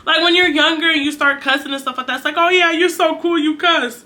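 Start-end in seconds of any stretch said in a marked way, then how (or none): 2.4-4.0 mock admiring kid. Mimics young peers admiring cussing.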